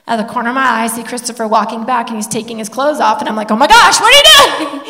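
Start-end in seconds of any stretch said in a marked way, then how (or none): 3.5-4.8 high, loud burst of comic disbelief; startled alarm. Retold or imitated. Mom seeing Christopher take his clothes off, shocked. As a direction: retell a shocked mom's outburst, laughing at the end. Mother startled by son undressing.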